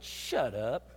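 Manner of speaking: a low rebuke from above; understated divine rebuke; voice of God. Retold or imitated. God answers his complaint.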